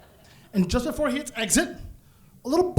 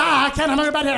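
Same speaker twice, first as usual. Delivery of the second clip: giggly high excuse; laughing excuse; 6 semitones higher, much faster than usual. Retold or imitated. To the boss, laughing off the camera request. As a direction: laugh it off, higher. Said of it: "So I'm like".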